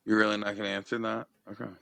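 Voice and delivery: ordinary talk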